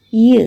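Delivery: "y" as the glide that starts you; "y" as a short glide, no vowel; isolated y sound. Shown as a demonstration. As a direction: glide "y" alone. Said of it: "Example, you".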